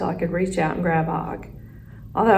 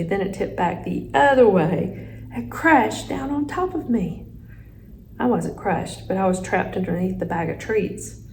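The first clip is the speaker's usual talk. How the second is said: ordinary delivery, nothing marked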